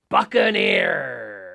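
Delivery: slow, low, loud pirate voice; pirate announcement. Pretend. Pirate introducing the next word to children, low drawn-out roar.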